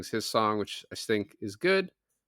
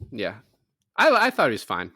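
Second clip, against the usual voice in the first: ordinary delivery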